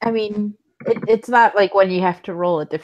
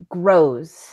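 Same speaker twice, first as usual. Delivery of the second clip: ordinary talk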